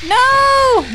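high sharp cry; frightened shout. Real, not acted. Monster attacks her.

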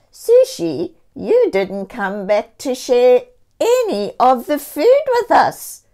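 high, loud scolding kitty voice; a cat friend voicing a complaint. A narrator's reading. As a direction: tell her off as a hurt friend. Cat character Coffee voiced.

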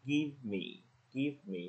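"Give me" with v flowing into m; no added vowel inside "Give me"; light V. Shown as a demonstration. Teacher modelling natural speech, "Give me" with light v. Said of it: "nó tự thành cái âm nhẹ đấy".

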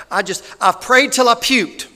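loud, high-pitched impression of a mom; big, emphatic imitated outburst; Miss Sherry. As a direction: blurt it out at full volume as her. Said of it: "that sounds just like Sherry".

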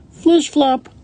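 very high, loud flish-flop; fish flip-flopping. A narrator's reading. Fish jumping out of the water, high loud flops. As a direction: squeak out a fishy flop. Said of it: "A fish jumping out of the water".

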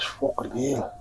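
soft, hushed, nearly whispered; hushed discovery. Retold or imitated. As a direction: drop to a hush as if spotting trouble. Discovering the dead chickens.

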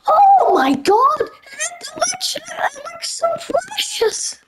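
high slow squeal over something cute; squealing at cuteness; squealing gush. Real, not acted. Gushing at cute avatar.

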